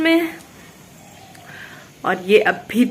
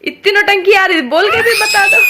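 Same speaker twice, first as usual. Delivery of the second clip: much higher, louder, laughing through it; laughing tease; 9 semitones higher, 4 dB louder than usual. Real, not acted. Mother laughing at her toddler's drama. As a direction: tease him while laughing.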